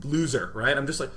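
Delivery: usual speaking voice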